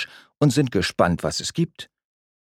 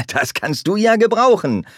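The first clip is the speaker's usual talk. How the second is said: high, loud teasing after a laugh; laughing tease; 12 semitones higher, 4 dB louder than usual. A narrator's reading. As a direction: poke fun at your friend. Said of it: "lacht er".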